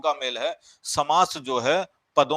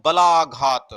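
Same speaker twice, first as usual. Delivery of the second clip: बलाघात said louder, with बा and ला pressed harder than the other sounds; stress demo; 7 dB louder, slower than usual. Shown as a demonstration. Teacher showing students where बलाघात takes stress, बा and ला pressed. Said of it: "बा पे और ला के ऊपर ज्यादा हमने focus किया".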